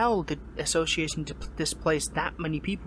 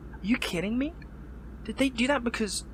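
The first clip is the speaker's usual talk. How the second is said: higher-pitched, indignant disbelief; raised, incredulous questioning; 7 semitones higher than usual. Acted. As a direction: react as if you can't believe it. Disbelief at accusation against association.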